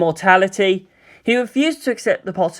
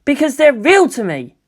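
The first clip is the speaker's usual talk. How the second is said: a higher, louder snarl; 8 semitones higher, 4 dB louder than usual. Acted. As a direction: snap it back in anger. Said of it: "Shinji snarled".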